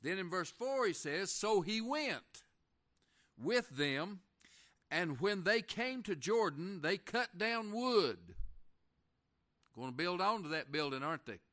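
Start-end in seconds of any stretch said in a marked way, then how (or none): 1.3-8.3 loud, high preacher's reading, slowly drawn out; preacher declaiming scripture. Acted. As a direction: proclaim the verse, stretching the final words. Reads scripture aloud.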